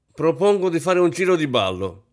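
rally the guests with brio; brisk and lively. A narrator's reading. Kampf proposes a dance to the guests, with brio. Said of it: "battendo le mani con brio".

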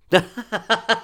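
sudden high-pitched burst of laughter; high laugh that cracks out. Acted. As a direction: let the laugh explode out of him. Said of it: "un attacco di risa".

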